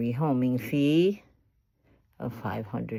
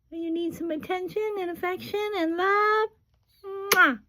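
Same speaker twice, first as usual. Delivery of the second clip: cooing pet talk. Real, not acted. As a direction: dote on a bird in a squeaky voice. Cooing and kissing the bird.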